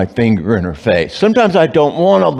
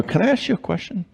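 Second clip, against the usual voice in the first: soft, higher, careful ask; gentle question; 8 semitones higher, 8 dB quieter, faster than usual. Retold or imitated. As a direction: approach your wife softly and gently. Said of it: "I go".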